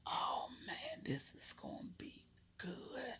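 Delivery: very soft, breath-only whisper; whispered anticipation. Retold or imitated. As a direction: whisper it to yourself, savoring the moment. Secretly savoring the jar.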